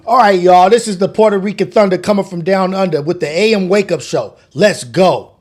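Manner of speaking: loud hype radio intro; radio announcer voice. Shown as a demonstration. Streamer doing his morning show voice, loud and hyped. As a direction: boom it out like a morning DJ. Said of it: "my radio reality voice".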